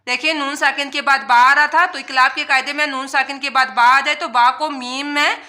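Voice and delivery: ordinary delivery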